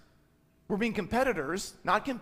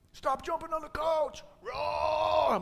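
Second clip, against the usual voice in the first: mock angry yell; 10 semitones higher, slower than usual. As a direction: bark it then roar like an angry parent. Angry parent yelling at kids.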